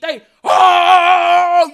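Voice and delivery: loud, high excited cry; excited shout. Real, not acted. Church erupts, she shouts.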